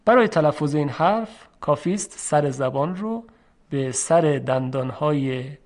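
usual speaking voice

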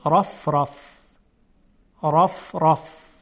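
heavy, thick ر in «رف رف»; full dark r in each «رف»; تفخیم. Shown as a demonstration. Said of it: "در صورتی که تفخیم بشه". («رف رف» با رای درشت و مفخم)

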